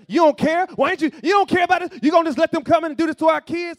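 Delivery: very loud, high, panicked yelling; frantic accusation. Retold or imitated. Believer yelling at a too-calm friend in a crisis. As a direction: yell it in a frantic rage. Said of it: "You say, you try".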